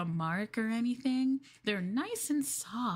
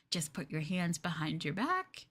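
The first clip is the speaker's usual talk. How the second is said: ordinary talk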